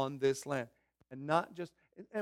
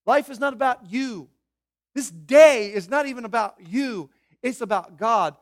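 raised, emphatic preaching voice; preacher's bold declaration; 5 semitones higher, 9 dB louder than usual. Acted. Pastor confronting the congregation's pride, loud and emphatic. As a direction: press it on them, line by line. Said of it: "When I stand before you and say".

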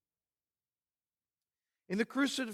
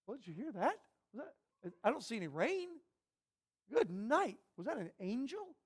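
hushed, higher-pitched comic puzzlement; mock bewilderment; 5 semitones higher, 8 dB quieter than usual. Preacher voices crowd who missed God speaking, mock bewilderment. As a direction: play a baffled bystander, softly questioning with rising ends.

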